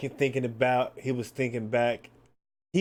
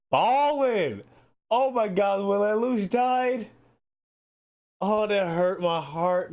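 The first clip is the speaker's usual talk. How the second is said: emotional outburst; 9 semitones higher, 4 dB louder than usual. Real, not acted. Remembering Lelouch's death.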